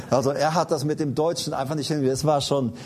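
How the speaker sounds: ordinary delivery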